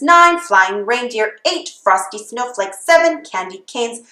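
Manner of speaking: plain reading voice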